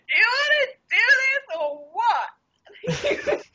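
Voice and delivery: high, slow, crying shout; drunken wail. Retold or imitated. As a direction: wail it out like a sloppy drunk begging. Drunk topless on the carpet.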